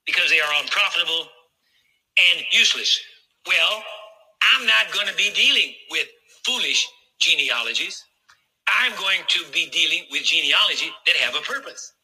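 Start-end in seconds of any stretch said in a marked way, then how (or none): none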